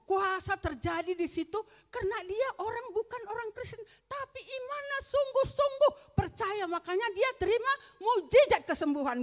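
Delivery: rising preaching fervor. Acted. Testimony rises to preaching climax. (semangat berkhotbah yang memuncak)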